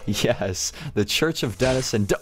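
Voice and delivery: usual speaking voice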